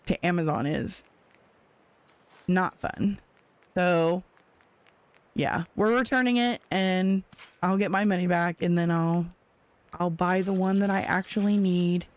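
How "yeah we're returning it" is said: normal talking voice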